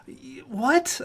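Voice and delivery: high startled what, breaking into a laugh; can't believe the lineup, laugh it off; baffled laugh. Real, not acted. Gamer baffled by his coach's goalie pick, high startled laugh.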